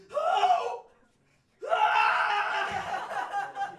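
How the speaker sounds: very high, shrill panicked shriek; panicked scream. Retold or imitated. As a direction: scream for help in reenacted panic. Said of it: "I was just screaming and yelling".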